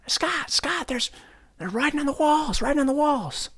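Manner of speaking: wife shouting to Scott about the walls; panicked wife. Retold or imitated. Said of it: "my wife's shouting at me".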